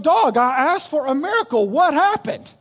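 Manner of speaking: loud, high-pitched indignant protest; unhappy complaint. Acted. Drowned man confronting Jesus in heaven, indignant complaint. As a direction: demand an explanation, voice climbing. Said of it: "Our boy's not that happy".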